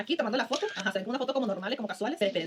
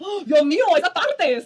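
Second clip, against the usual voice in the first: loud, high burst of amazement; excited shout; 5 semitones higher, 10 dB louder, much slower than usual. Real, not acted. Reacting to a gym transformation, loud amazed shout. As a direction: cry out at the gym result.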